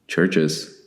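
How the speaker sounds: ordinary talk, nothing marked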